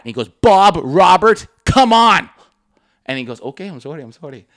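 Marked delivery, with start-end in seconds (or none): 0.3-2.3 loud, raised, exasperated word by word; mock screaming customer. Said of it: "this guy is just screaming at him". 3.4-4.5 soft, fading apology; small voice, trailing away; meek apology. Retold or imitated. Cowed clerk apologizing in retelling.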